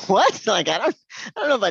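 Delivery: high, loud incredulous what; shocked what. Retold or imitated. Doctor stunned by the sister, loud high incredulous what. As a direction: react in total disbelief.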